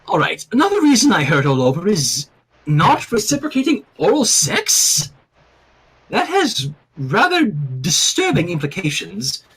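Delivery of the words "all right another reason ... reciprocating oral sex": ordinary delivery, nothing marked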